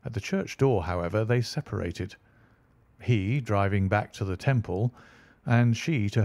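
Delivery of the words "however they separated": ordinary delivery, nothing marked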